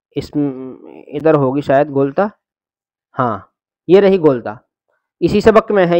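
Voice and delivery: ordinary delivery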